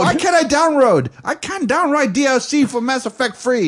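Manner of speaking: high, loud whining complaint; whiny Kim voice. Retold or imitated.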